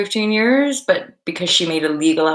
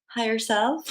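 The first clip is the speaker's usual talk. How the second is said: softer, slightly higher voice; imagined inner reply; 4 semitones higher, 7 dB quieter than usual. Retold or imitated. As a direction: say it small, as if only in your mind. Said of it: "I didn't speak it out loud".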